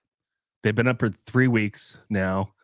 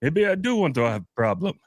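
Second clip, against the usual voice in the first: higher, mock defiant Indian accent; 6 semitones higher than usual. Man answers the dad's threat: mock defiant Indian accent. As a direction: toss back the challenge in a lightly put-on Indian accent. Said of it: "And he goes".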